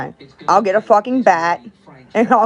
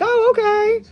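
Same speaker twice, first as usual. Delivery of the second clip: high, mocking "oh, okay" of a gullible viewer; mock gullible reply; slower than usual. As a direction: ape the naive follower who believes anything. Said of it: "you guys just are like".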